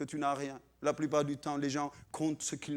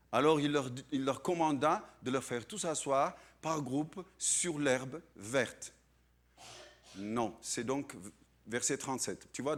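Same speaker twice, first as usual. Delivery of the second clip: usual speaking voice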